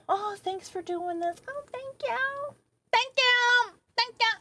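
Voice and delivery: mocking sweet little thank-yous; mock gushing thanks. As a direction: mimic thankful passersby in a small high voice. Mimics passersby thanking them.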